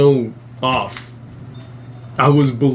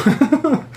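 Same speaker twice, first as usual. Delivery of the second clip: high disbelieving laugh; 13 semitones higher than usual. Real, not acted. Laughing at absurdity.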